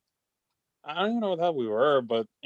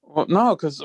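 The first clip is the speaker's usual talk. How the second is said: normal talking voice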